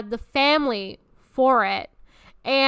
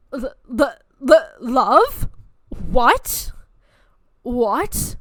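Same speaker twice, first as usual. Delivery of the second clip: hammed-up shock, rising on each "what"; mock panicked stammer; 2 semitones higher, 8 dB louder, much slower than usual. Twenties guy spooked by the L word, mock panicked stammer. Mimics twenties guys hearing love.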